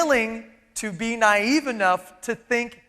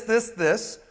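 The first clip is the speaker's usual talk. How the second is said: ordinary delivery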